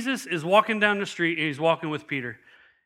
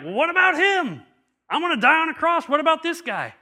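high, loud protest as Peter; Peter's indignant, much higher squeak of disbelief; incredulous Peter; 11 semitones higher, 5 dB louder than usual. Retold or imitated. Peter protests to Jesus, high and indignant. Peter complains about John.

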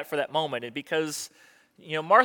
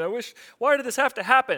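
higher-pitched imitation of a tearful mourner; mock crying lament; 6 semitones higher than usual. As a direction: plead as if crying, lightly acted. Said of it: "somebody's gonna be crying".

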